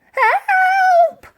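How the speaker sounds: very high, loud shriek; old lady shrieking as she is thrown about. A narrator's reading. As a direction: scream for help. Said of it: "she shrieked".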